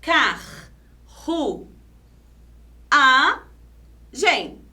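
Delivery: "Car, ru, a, gen" as four separate beats; syllable by syllable. Shown as a demonstration. Teacher showing the syllable division, syllable by syllable. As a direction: pause after each syllable, strong friction R. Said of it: "a divisão silábica vai ficar assim".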